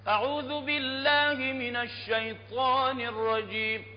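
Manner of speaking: slow, high chanted recitation of كاف ها يا عين صاد; melodic recitation. Shown as a demonstration. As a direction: sing the recitation with long drawn vowels. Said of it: "أعيدها". (تلاوة مجوّدة بنغمة عالية)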